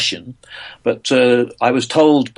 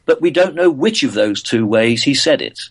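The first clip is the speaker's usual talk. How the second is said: ordinary delivery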